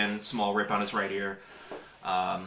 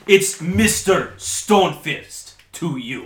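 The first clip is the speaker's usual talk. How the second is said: slow, louder stern correction; 6 semitones higher, 9 dB louder, slower than usual. Acted. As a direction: drag it out as a warning. Dwarf correcting the boy.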